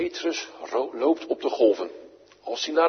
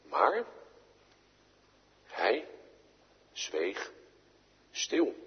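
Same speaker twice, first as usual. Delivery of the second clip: hushed; 4 semitones lower, 9 dB quieter, much slower than usual. A narrator's reading. Silent Jesus, hushed telling.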